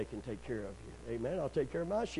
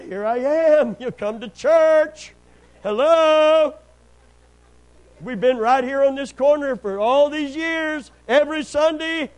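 loud, high, drawn-out mock holler; exasperated shout; 11 semitones higher, 14 dB louder, slower than usual. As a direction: holler it as if they can't see you. Exasperated retort to elusive couple.

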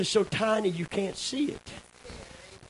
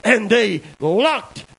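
loud, ringing storyteller's proclamation; the old preacher's battle story, declaimed at full voice; preacher's retold vision; 7 dB louder than usual. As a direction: boom the battle tale out to the church. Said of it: "And he said".

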